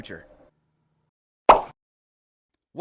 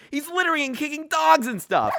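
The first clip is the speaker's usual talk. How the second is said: mock outrage; 8 semitones higher, 3 dB louder than usual. A narrator's reading. Outraged narration of crimes.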